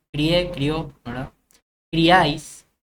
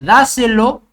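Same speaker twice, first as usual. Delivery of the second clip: "Dáselo" called out louder and higher, the stress on "Dá"; stressed example word; 5 semitones higher, 8 dB louder than usual. Shown as a demonstration. Teacher presenting "Dáselo" as an esdrújula, loud and high on "Dá". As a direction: punch the "Dá" of "Dáselo". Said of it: "es una palabra esdrújula también".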